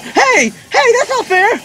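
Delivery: high, indignant yelling; outraged protest. Real, not acted. Player accusing the boss of cheating, outraged. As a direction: shout it like you've been cheated.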